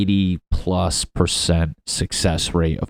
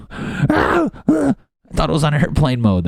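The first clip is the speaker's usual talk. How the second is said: mock surprise; 9 semitones higher than usual. Caught naked at home.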